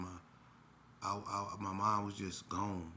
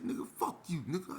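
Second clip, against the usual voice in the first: rival's retort; 9 semitones higher than usual. Retold or imitated. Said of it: "He said".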